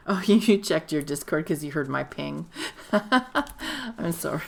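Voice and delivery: talking through giggles; laughing. Real, not acted. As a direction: laugh while apologising. Laughs at a chat comment.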